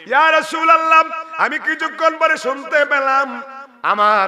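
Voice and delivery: high, loud lamenting call; full-force preaching wail; crying out. Acted. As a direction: cry the refrain out to the crowd. Preacher cries out the refrain.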